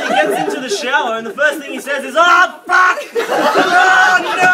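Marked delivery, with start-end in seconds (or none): 2.2-4.5 very high, very loud mock scream; hammed-up shout of dismay; horrified outburst. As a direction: scream in comic horror. Dad's first reaction in the shower.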